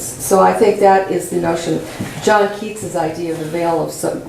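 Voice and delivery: usual speaking voice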